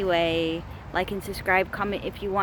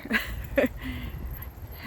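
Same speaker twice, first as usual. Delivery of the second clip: breathless laugh; 4 semitones higher, 4 dB quieter than usual. Real, not acted. Laughing after an exhausting walk.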